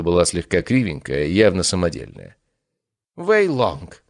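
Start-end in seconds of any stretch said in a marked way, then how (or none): 3.1-4.0 high, theatrical call of the name; dramatic exclamation. Acted. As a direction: cry the name with wild overacting. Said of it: "драматично воскликнул явившийся".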